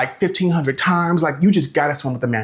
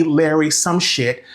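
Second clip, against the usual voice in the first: normal talking voice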